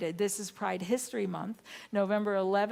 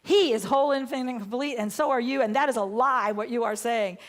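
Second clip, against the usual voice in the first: loud, raised, emphatic correction; retold angry rebuke; 4 semitones higher, 8 dB louder than usual. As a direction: re-enact confronting the man. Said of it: "Somehow I got so mad".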